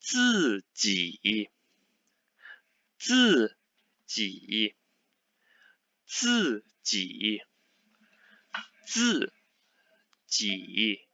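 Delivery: teacher drilling 自己 for dictation; modelled Mandarin word. Shown as a demonstration. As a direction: break 自己 into slow separate syllables. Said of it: "the word is".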